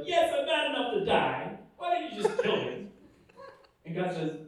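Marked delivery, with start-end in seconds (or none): 0.0-2.9 high petulant complaint, half joking; high-pitched, petulant mock rage; whiny mock anger. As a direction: sulk like a spoiled kid. Jonah sulking at God.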